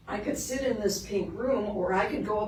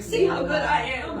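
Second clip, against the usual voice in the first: proud dog voice; 6 semitones higher, 6 dB louder than usual. Retold or imitated. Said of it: "into the living room going".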